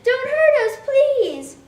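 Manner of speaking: high, loud frightened squeal; squealing pigs. Acted. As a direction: cry out like terrified little pigs. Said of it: "They squealed loudly and said".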